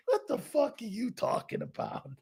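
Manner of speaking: soft, laughing disbelief. Real, not acted. Host cracking up at his own impression, laughing through it. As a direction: crack up while getting the words out.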